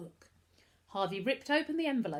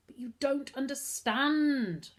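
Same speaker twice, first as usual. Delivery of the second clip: slow, higher sulky protest; 7 semitones higher, 5 dB louder, slower than usual. A narrator's reading. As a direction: whine it like a sulking boy. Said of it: "Lloyd began sulkily".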